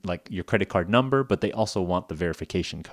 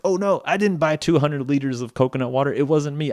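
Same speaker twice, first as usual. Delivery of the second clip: mildly higher, mock flustered denial; panicked; 5 semitones higher than usual. Victim denying charges to the scam caller, mock panic. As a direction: act out a lightly hammed panic. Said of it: "they make you panic".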